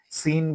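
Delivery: "সিন" with a light hissing s; wrong thin seen. Shown as a demonstration. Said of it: "বলা যাবে না". ("সিন"-এ ভুল পাতলা স)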